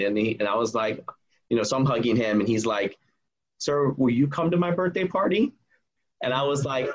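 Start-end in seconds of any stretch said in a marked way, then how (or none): 3.6-5.5 higher-pitched kid's plea, lightly acted; imitating tearful kid. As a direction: retell a boy's timid, pleading question. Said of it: "And so he's bawling".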